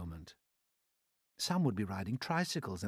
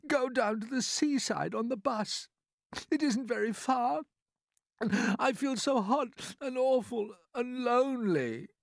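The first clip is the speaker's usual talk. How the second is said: put-on high, louder small-boy voice; pleading cry; 14 semitones higher, 4 dB louder than usual. Boy begging his aunts for a seaside trip, pleading. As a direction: plead in a high child's voice, drawing out the misery. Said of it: "James cried out".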